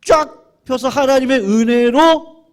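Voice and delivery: high, loud, stretched-out sermon cry; fiery pulpit delivery; full-force preaching. Acted. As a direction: preach it to the back row.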